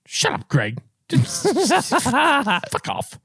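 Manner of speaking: mock flustered snapping, breaking up into laughter; flustered mom. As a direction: imitate a rattled mom telling someone off. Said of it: "Gets so flustered".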